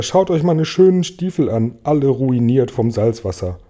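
ordinary delivery, nothing marked

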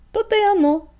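high, loud scoffing retort; amused snort. A narrator's reading. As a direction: snort it back with relish. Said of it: "фыркнул приятель".

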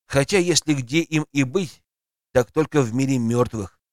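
plain reading voice

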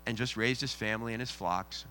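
usual speaking voice

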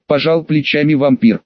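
usual speaking voice

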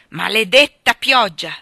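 loud, slow curse at the weather; angry exclamation. Acted. Husband curses the rain at the window, loud angry cry. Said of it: "esclamò".

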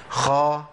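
خا with breath running through the خ; خاء مفتوحة مهموسة; kha with fatha. Shown as a demonstration. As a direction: say خا with audible air on the خ. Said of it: "ركزوا معايا تاني".

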